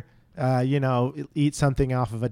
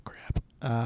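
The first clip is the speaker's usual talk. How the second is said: whisper; 8 dB quieter than usual. Real, not acted. Mental arithmetic under breath.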